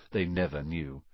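ordinary delivery

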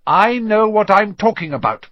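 high, emphatic rebuff; short, curt. A narrator's reading. McGonagall silencing Potter's protest, curt. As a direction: cut off the objection firmly. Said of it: "she said shortly".

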